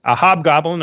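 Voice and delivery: normal talking voice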